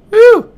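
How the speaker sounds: sudden loud, very high "woo"; high whoop. Real, not acted. Player reacting to the game, sudden high whoop. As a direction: let out a piercing little whoop.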